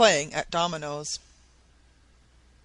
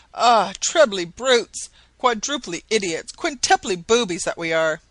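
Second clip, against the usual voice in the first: exclaiming; 2 semitones higher, 6 dB louder than usual. A narrator's reading. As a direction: shout it at his fellow fools. Maston cries out his discovery.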